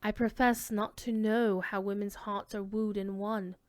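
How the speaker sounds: usual speaking voice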